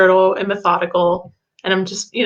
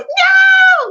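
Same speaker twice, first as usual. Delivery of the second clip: high, loud mock child yell; kid shouting; 7 dB louder than usual. Son screaming at his father, high yell. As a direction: shriek a bratty no. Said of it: "the kid just goes".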